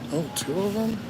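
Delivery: ordinary delivery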